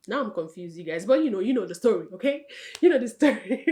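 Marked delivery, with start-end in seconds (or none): 2.8-3.7 loud, laughing through the words; amused, louder delivery breaking into laughter. Real, not acted. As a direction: laugh at your own mix-up. Laughs at her own muddled story.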